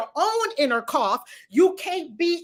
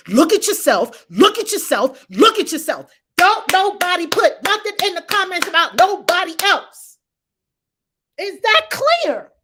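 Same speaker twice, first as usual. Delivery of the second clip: loud repeated commands, climbing higher; forceful scolding; 5 semitones higher, 7 dB louder than usual. Real, not acted. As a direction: drum the order into them.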